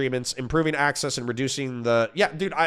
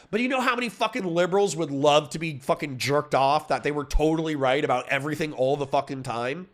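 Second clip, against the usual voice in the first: normal talking voice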